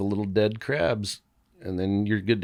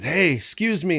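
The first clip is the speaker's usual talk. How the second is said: higher-pitched mild mock yell; retold calling out; 7 semitones higher than usual. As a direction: hail someone at the front of a line, held back. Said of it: "I just yelled up to the front".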